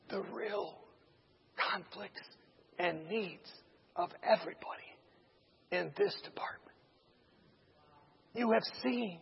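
soft, halting near-whisper; hushed, measured explanation. Retold or imitated. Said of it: "whispering to each other again".

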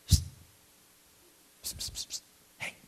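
hissed whisper calls; sneaky whisper from the jail; whispered psst. Acted. Old man in jail whispering to get attention.

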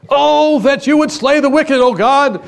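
high, loud declaimed shout; psalmist's outcry. Acted. Preacher acts out psalmist calling on God, impassioned high shout. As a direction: cry out to God at full force, acting it out.

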